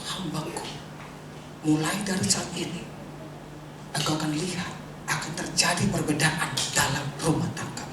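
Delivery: low, soft retold promise; solemn, softly spoken prophetic message; quiet prophecy. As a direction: drop low and hushed to voice God's word. Retells God's promise through a child.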